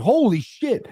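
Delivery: high-pitched mock gasp of shock; retold astonishment. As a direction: act out being stunned, pitch jumping up. Retelling his shock at the light.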